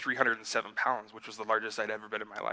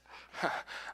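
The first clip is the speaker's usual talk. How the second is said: soft laugh; 7 dB quieter than usual. Real, not acted. Joking about race times.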